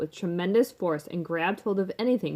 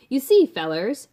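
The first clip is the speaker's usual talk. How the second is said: louder, higher father addressing the press; boastful rich father; 5 semitones higher, 8 dB louder than usual. A narrator's reading. Mister Salt explains to the newspaper man, boastful and loud. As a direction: hail them as a self-important businessman.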